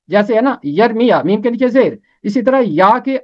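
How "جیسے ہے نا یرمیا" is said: ordinary talk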